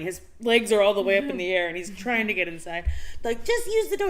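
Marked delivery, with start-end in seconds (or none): 3.5-4.1 very high, loud mock scold. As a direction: squeak it out like a fed-up flatmate. Vampires scold the flying newcomer.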